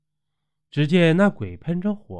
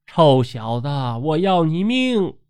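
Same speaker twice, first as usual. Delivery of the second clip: roared threat; 3 semitones higher than usual. A narrator's reading. Possessed Jing'er threatening to kill the boy, roared threat. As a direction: roar it as a threat, lifting sharply at the end. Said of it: "随即大吼一句".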